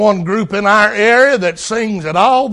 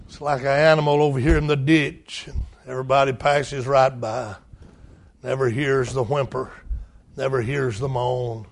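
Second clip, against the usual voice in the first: hushed sorrow; 6 semitones lower, 8 dB quieter than usual. Real, not acted. Drops to soft after shout.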